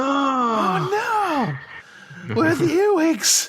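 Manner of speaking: high hammed-up wail; mock anguished cry. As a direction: howl it in mock despair. Khan-style mock cry.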